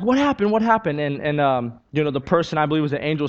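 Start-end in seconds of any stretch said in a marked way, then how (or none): 0.0-1.0 high, urgent repeated question; mother rushing in at her child's scream, alarmed; alarmed mom. Retold or imitated. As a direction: burst in asking in a panic, pitched high.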